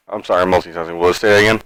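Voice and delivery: ordinary delivery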